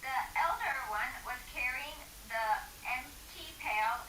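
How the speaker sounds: soft hushed storytelling voice; whispered reading. A narrator's reading. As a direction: read it in a near whisper.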